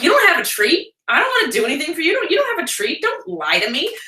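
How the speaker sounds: a stubborn pup who knows there's no treat; dog's voice. Retold or imitated.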